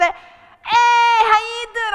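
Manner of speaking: loud, high put-on cheeriness; over-bright greeting. Caller ringing back after fuming, over-cheery. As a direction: sing out a hello, hiding the earlier fuming.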